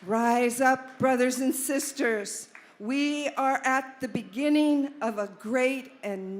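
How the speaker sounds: loud rallying call to the crowd; fiery revolutionary speech. Acted. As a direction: rouse them, rise up loud.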